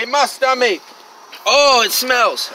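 loud slow groan of disgust; disgusted outcry. Real, not acted. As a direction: cry out at the stink. Snake musk sprayed on him.

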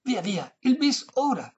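high, emphatic demand; insistent. A narrator's reading. Old lady demanding her encore, insistent high voice. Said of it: "insisté la vecchia".

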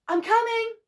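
high, loud answering call. A narrator's reading. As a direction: shout reassurance toward the voice. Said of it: "Min Lee called".

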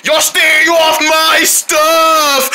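high, slow stretched-out holler; play-acted rage. Owner warning off thieves, high drawn-out angry holler. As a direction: belt it out, dragging every word. Guitar owner rages in song.

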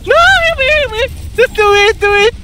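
high, loud pleading yell for heals; panicked shout over the fight; frantic yelling. Real, not acted. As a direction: scream at your healer to save you. Desperate call for heals mid-fight.

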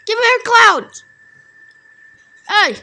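loud, high-pitched demand; demanding shout. Real, not acted. Gamer to opponent, give me your cloud, loud high demand. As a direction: yell it at the opponent.

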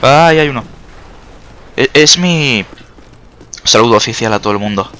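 ordinary talk, nothing marked

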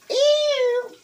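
loud high squeal of disgust. Real, not acted. Looking at the ribs, a loud high squeal.

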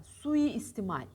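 slow, loud suistimal with the i held. Shown as a demonstration. Teacher correcting suistimal with a single long i. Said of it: "tek i var orada".

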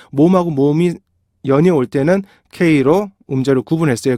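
normal talking voice

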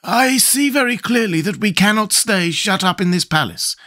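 high, firm scarecrow voice; decisive. A narrator's reading. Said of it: "said the Scarecrow with decision".

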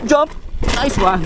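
normal talking voice

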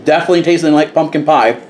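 chewing while talking; speaking between bites; mouth full. Real, not acted. Seen 1: bites at -7; chewing still seen through +2.4, then clear speech.

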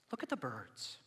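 soft, understated invitation; calm instruction. Acted. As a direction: offer it quietly and simply. Calm verse before mocked reaction.

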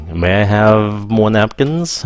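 slow, careful; polite request. Shown as a demonstration. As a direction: model the polite version slowly. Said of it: "lịch sự hơn".

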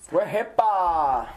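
mock proud. Mock solemn joke.

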